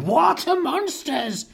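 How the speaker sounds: high-pitched yelp of alarm; frightened cry. A narrator's reading. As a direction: yelp it in panic. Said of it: "one cried".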